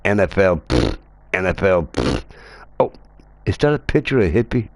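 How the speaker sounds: normal talking voice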